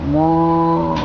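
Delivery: low, slow mooing cow. A narrator's reading. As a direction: moo like a cow, deep. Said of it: "The cow says".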